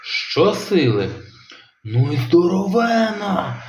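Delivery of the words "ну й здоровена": high, amazed exclamation. A narrator's reading. Dad amazed at the big fish, high exclamation. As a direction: marvel at the huge catch. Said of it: "дивувався тато Рекс".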